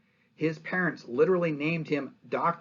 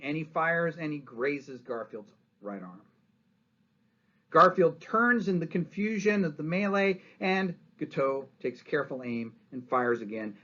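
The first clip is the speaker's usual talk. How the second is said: ordinary delivery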